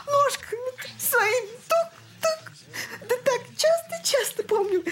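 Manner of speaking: high, breaking voice, on the edge of sobbing; tearful grief. Acted. Grieving mother to the elder about her dead son, crying. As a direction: she aches to hear his little feet again, crying through it.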